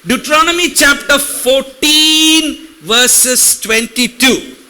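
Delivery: slow, drawn-out verse reference; slow deliberate scripture reference. Shown as a demonstration. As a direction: stretch the citation out slowly for the congregation. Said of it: "If I go slow".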